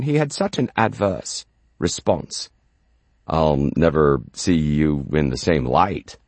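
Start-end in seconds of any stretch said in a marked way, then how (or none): 3.3-6.2 low, grave American-style put-down; low voice of a let-down acquaintance; disapproving acquaintance. Acted. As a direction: say it low and final. Acquaintance's adverse response, quoted.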